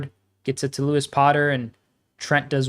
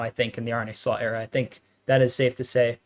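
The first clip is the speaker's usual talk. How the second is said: ordinary delivery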